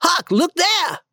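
high excited call; shouted. Acted. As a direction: call out on finding treasure. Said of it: "Tom went around a corner and shouted".

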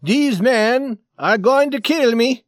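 high, loud, emphatic alarm; eager, frightened plea. Acted. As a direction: report the threat urgently, drawing out the words. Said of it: "answered him quickly and eagerly".

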